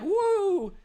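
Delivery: high, loud cartoon whoop; squealing cartoon cheer; excited whoop. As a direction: whoop with glee. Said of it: "he's like".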